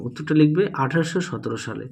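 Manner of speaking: usual speaking voice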